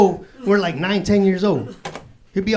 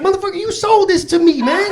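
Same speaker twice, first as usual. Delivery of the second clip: high, loud accusing protest; mock indignation; 12 semitones higher, 5 dB louder than usual. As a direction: confront the dealer, hammed-up and outraged. Confronted guard who sold the weed.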